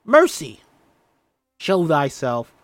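high loud shout; yelling. Acted. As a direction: shout her out of hiding. Thomasin yelling for hidden sister.

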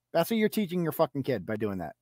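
usual speaking voice

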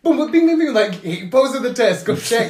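excited mock alerts. Classmates spreading the grade news, excited mock chatter. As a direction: rattle off the news like notifications. Acting the group text alerts.

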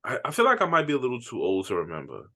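ordinary talk

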